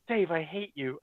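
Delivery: hushed, deadpan retold grievance. Embarrassed guest to Dave after the interview, quietly resentful.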